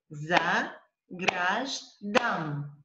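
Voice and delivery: "za, graj, dam" in three slow separated beats; syllable by syllable. Shown as a demonstration. Teacher claps out syllables for student, syllable by syllable. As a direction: break "za, graj, dam" apart as if clapping. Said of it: "separate the words in different syllables".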